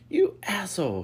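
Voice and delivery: high, loud outburst; indignant shout. A narrator's reading. Lucas surfacing after being thrown in, shouting. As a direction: shout it in protest. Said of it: "he shouted at me".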